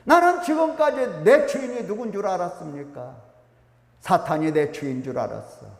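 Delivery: ordinary talk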